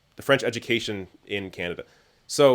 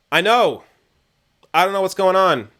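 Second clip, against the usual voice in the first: exasperated; 7 semitones higher, 5 dB louder than usual. Real, not acted. Exasperated at the notifications.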